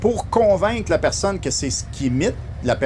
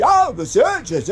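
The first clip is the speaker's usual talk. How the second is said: high, exaggerated imitation; 8 semitones higher, 3 dB louder than usual. Guest mimicking a radio comic's exaggerated singer, high caricature. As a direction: overdo the impression, pitched way up. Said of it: "qui faisait [name]".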